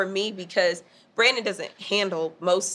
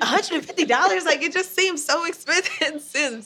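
higher, laughing protest over the price; retelling her balk at the price, pitched up; 6 semitones higher than usual. Retold or imitated. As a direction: squeak out the price complaint with a laugh. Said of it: "And I'm like".

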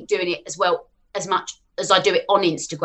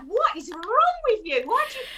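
incredulous retort; 4 dB quieter than usual. Retold or imitated. Answering online trolls, she mimics a high baffled retort. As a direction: imitate yourself baffled at a troll. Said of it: "I'm like".

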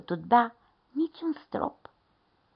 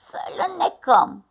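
high, loud, slow bear voice; middle bear; 10 semitones higher, 6 dB louder than usual. A narrator's reading. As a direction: propose it firmly as the middle bear.

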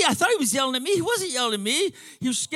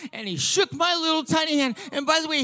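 ordinary delivery

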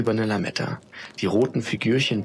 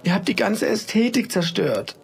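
high, dismayed complaint; mourning her ruined decor; distraught, high-pitched lament; aghast; 10 semitones higher than usual. Acted. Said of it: "stammelte sie nun fassungslos".

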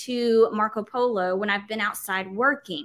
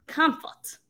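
a light Southern drawl on Comfort, drawn out and higher; 6 semitones higher than usual. Shown as a demonstration. Host sounding out her misspelled word in a mild Southern drawl. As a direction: Comfort said with a lazy Texas drawl, half joking. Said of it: "the southern drawl that's coming out of me".

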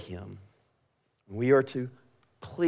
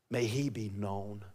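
hushed reverence; 2 semitones lower, 11 dB quieter than usual. Acted. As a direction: let it fall away quietly. Sudden hushed close of sermon climax.

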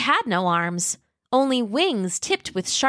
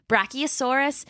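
plain reading voice

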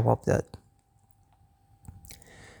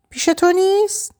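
higher, startled question; surprised Greg. A narrator's reading. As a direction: caught off guard. (گرگ غافلگیر، با صدای زیرتر)

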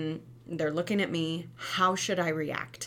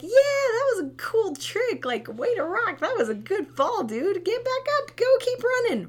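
very high, loud cheer for a fall; cheering parent; 7 dB louder than usual. Retold or imitated. Mom at the park cheers fallen toddler, very high excited praise. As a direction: whoop it up like a hype coach. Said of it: "Or like".

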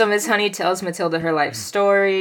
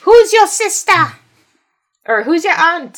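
mock high voice; 10 semitones higher, 7 dB louder than usual. Mimicking a character's question.

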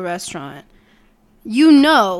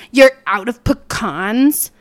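slow, loud mock indignation; mad customer; 6 dB louder, slower than usual. Old woman to the drive-through worker, imitated indignation. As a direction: act out an affronted customer, drawing it out. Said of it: "when people get mad at you".

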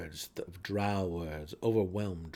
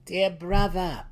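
higher, louder, slow sweet address; false sweetness; 8 semitones higher, 9 dB louder, slower than usual. A narrator's reading. Brisa buys time with her brother, falsely sweet. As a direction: stall with honeyed words.

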